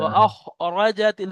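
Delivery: ও আখরাজাতিল with a clear glottal start and slow খ; slowly, throaty খ in আখরাজাতিল; clear hamza. Shown as a demonstration. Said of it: "দেখেন হামজাহা". (আখরাজাতিল এ খ গলা থেকে ধীরে)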